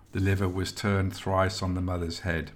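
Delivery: plain reading voice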